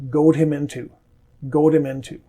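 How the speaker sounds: goat him into with a faint D before him; light D. Shown as a demonstration. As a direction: goat him into, just a touch of D. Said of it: "there we did hear the D a little bit".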